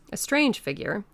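usual speaking voice

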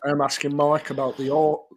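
normal talking voice

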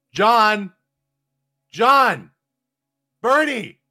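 high, loud urgent shouting of names. Acted. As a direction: yell to be heard inside. Calling through the letterbox.

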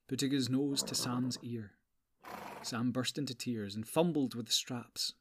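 plain reading voice

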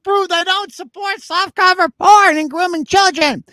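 shrill indignant voice. Pretend. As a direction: play the outraged complainer. Mock-voicing the complainer's answer.

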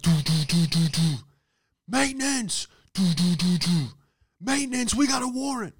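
high, loud mock bellow of a worker pounding on the door; maintenance guy yelling. Maintenance man hollering through the apartment door, loud and high. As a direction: belt it like a cop with a warrant.

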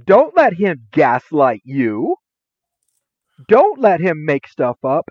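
put-on rally the kids against him; hammed-up urging; cheering on. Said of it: "I'm like".

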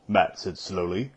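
plain reading voice

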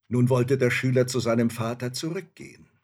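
usual speaking voice

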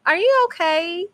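put-on high-pitched little-kid questioning; little boy. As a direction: imitate a small son checking on mommy. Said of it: "sometimes he be like".

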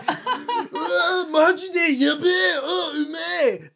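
high, slow ecstatic Japanese gush; over-the-top TV foodie. Retold or imitated. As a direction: moan the praise like a TV taster. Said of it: "has this reaction like".

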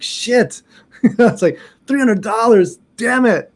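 high, exasperated retold cursing; comic dismay. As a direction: act out pained shock at a bill. Retells dismay at the cost.

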